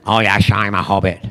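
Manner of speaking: deep mock Scottish slur, shushed s; deadpan Scottish impression as a punchline; Sean Connery. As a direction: deliver the running gag in low film-star Scots. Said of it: "Sean Connery was never in The Hobbit".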